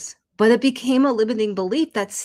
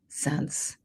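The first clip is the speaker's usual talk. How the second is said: whispered; 6 semitones lower, 9 dB quieter than usual. Real, not acted. Trailing question whispered.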